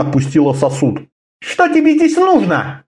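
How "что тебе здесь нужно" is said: sharp, much higher demand. Acted. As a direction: snap the question out sharply. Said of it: "резко спросила она".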